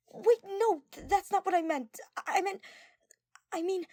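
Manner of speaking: high, loud, rushed backpedalling; flustered panic. Acted. Girl panicking to fix a confession gone wrong, high and loud. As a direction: scramble to take it back.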